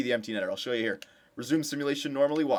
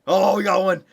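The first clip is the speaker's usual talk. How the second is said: excited cheer; 6 semitones higher, 9 dB louder than usual. Real, not acted. Goal scored in sim.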